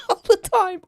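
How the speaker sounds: high voice full of laughter; real giggling; laughing. Real, not acted. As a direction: say it through a laugh. Laughing at the memory.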